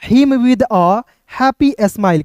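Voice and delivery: reads very slowly, word by word; slow, careful English read-aloud; slow model reading. Shown as a demonstration. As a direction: sound out each word slowly for the class. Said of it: "तो thanked".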